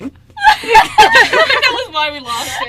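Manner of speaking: loud laughing shout; can't get it out for laughing; real laughter, loud and high. Real, not acted. Laughing hard at memory.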